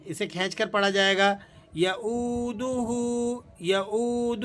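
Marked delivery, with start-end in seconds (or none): 1.7-4.5 یؤودہ with the waw one alif long; slow یؤودہ. Shown as a demonstration. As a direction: stretch the oo of یؤودہ. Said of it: "واو مدہ ہے، آپ نے اسے ایک الف کے برابر کھینچ لینا ہے".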